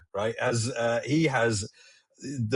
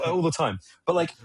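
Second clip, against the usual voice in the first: ordinary talk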